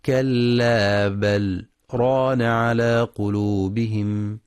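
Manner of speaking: a slight pause after بل, no breath, before ران; sakta demo. Shown as a demonstration. Teacher demonstrates the brief sakta on the lam. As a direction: recite كلا بل then a tiny breathless stop, then ران على قلوبهم. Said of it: "بسكتة لطيفة على اللام دون تنفس".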